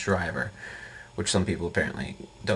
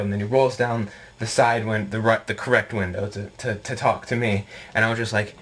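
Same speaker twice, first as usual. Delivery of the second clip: usual speaking voice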